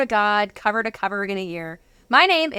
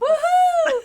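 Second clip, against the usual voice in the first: a much higher, celebratory whoop; excited cheer; 4 dB louder than usual. Real, not acted. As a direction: whoop in triumph at finally getting it right. Cheers after getting guest's name right.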